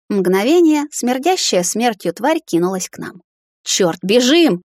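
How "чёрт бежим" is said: higher, louder panicked blurt; voice cracking in panic. A narrator's reading. Prince fleeing the charging beast, cracking panicked yell. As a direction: break the voice as you yell to run. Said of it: "срывающимся голосом выпалил Дориан".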